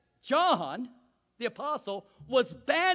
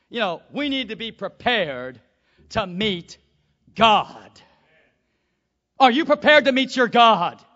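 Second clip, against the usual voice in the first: urgent preaching; 12 dB louder than usual. Acted. Preaching at full force.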